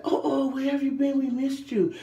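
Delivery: high, loud syrupy shop clerk greeting; fake sweet clerk. Shop clerk greeting a returning customer, gushing high. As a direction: gush like a phony saleslady.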